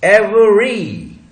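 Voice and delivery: Every stretched slowly, loud. Shown as a demonstration. Teacher to class, modelling the word. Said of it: "every बोला जाएगा".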